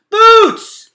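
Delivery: loud frantic shout; desperate calling shout. Acted. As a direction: yell her name again into the void. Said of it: "He called again".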